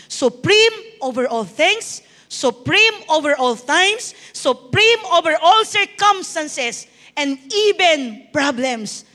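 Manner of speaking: slow, emphatic rhetorical build; preaching. Real, not acted. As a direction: declare each phrase slowly, stretching it out for the congregation. Preaches to congregation. (mabagal at madiing pangangaral)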